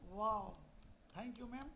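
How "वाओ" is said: loud, bright exclamation of delight; delighted wow. Real, not acted. Guest coach thrilled by the host's invitation, loud wow. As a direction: burst out with real joy at the welcome.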